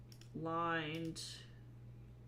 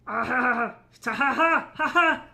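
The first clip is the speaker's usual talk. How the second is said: triumphant laugh; 7 semitones higher, 15 dB louder than usual. Real, not acted. Wins with forty-four points.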